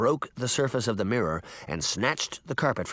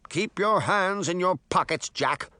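higher, prim little man's voice; insisting; 6 semitones higher than usual. A narrator's reading. Mirror warning Jack off the treasures, prim and insistent. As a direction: scold like a fussy curator. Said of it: "the man insisted".

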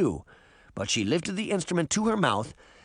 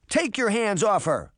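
higher-pitched protest; urgent, raised-pitch demand; urgent cry; 6 semitones higher than usual. A narrator's reading. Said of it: "Sabrina cried".

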